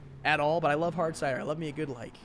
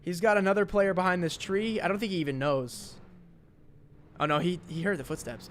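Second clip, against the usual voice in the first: ordinary delivery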